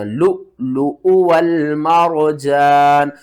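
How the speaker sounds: slow recited लु, लु उ वल Marjan; Quran recitation. Shown as a demonstration. Teacher recites the full phrase correctly for students. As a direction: chant it drawn-out like a reciter. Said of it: "यहाँ पर झटका आपने नहीं देना।".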